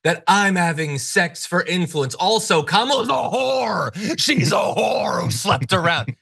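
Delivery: slow, exaggerated mocking voice; hammed-up parody of her rant; mocking impression of Loomer. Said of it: "multiple times".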